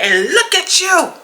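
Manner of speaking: high, mocking man's voice. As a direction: mimic him sneering up high. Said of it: "He was like".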